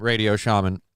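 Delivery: normal talking voice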